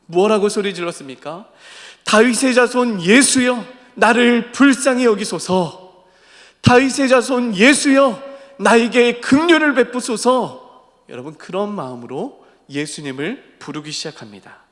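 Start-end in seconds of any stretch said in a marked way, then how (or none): 2.0-10.7 high, loud, drawn-out plea; earnest crying out. Acted. Preacher as blind Bartimaeus calling to Jesus, earnest shout. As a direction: call out desperately for mercy, slowly. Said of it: "간절하게 소리를 질렀습니다".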